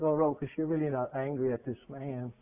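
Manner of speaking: low, soft and tender, fading away; God gently explaining to the preacher why he is angry; gentle voice of God. Acted. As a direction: speak softly as God consoling him. God answers the crying preacher.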